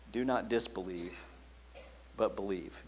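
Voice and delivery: soft repeat. Shown as a demonstration. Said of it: "preacher repeating softly".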